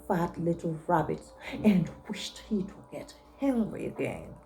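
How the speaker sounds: hushed, breathy storytelling; whispered reading. A narrator's reading. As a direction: drop to a whisper for the wish. Narration falls to whisper.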